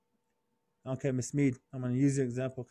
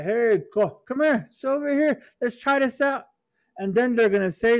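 pushy buddy; 4 semitones higher than usual. Pretend. Buddy pressuring Antoine to try drinking, pushy. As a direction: egg her on like a pushy friend. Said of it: "Your buddies gonna be like".

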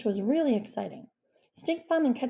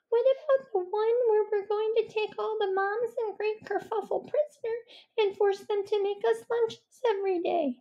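high badger voice; 12 semitones higher than usual. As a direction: play a timid badger, high and careful.

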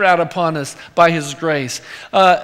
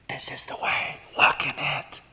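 soft unvoiced whisper from behind; 3 semitones lower, 11 dB quieter than usual. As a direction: breathe it like a voice at your shoulder. Holy Spirit speaking to heart.

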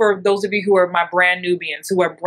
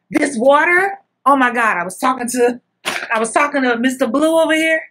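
excited husband; 5 semitones higher than usual. Retold or imitated. Husband relaying news.

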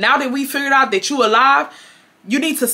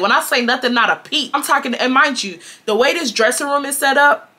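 normal talking voice

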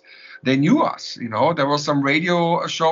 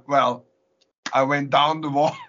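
ordinary talk, nothing marked

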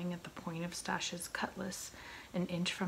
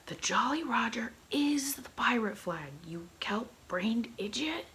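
loud, higher-pitched pirate captain losing patience; exasperated outburst; 5 semitones higher, 7 dB louder than usual. Acted. Pirate captain explaining the flag to his dim mate, exasperated shouting. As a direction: hammer it home to a fool, loudly.

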